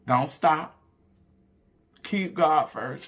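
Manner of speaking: usual speaking voice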